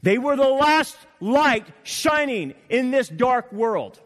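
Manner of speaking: loud, high, slow preacher's cry; fervent preaching, loud and high; forceful preaching. Acted. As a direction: drive the point home from the pulpit. Preaching climax.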